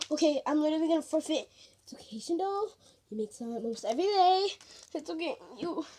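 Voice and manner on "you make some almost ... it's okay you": ordinary delivery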